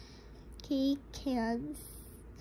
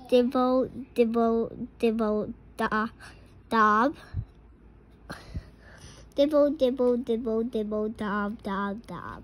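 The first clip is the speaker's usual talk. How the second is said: louder patter that fades away; rain drops; 3 semitones lower, 3 dB louder, faster than usual. Shown as a demonstration. Parent reading to a child, rain patter building then fading. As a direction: drip the raindrops then let them die down. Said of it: "He can sound like the rain".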